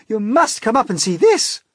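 a loud, high shout. Acted. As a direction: call him up with excitement. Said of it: "they shouted".

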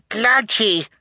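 put-on very high little-child exclamation; high-pitched baby outburst; baby's cry. A narrator's reading. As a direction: cry out like an infant. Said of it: "Sonny cried".

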